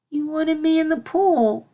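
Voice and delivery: high, slow plaintive filly; lamenting. A narrator's reading. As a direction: complain sadly to the princess. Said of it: "lamented the unicorn".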